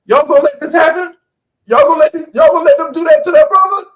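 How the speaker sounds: high, loud shaming call; calling out men. Retold or imitated. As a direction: shame the men into acting. Woman shaming bystanders aloud.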